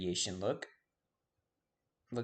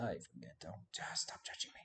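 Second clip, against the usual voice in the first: whisper; 7 semitones lower, 11 dB quieter than usual. Real, not acted. Aside to viewers while forgetting a name.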